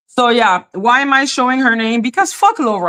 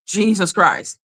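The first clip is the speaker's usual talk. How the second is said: ordinary talk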